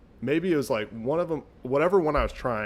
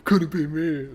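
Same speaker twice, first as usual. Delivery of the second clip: higher and louder, in a put-on smug voice; smug mock quote; 5 semitones higher, 6 dB louder, slower than usual. Mocking people hit by the sauce pump, in a smug put-on voice. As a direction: sneer it like a gloating bystander.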